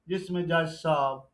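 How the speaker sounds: ordinary talk, nothing marked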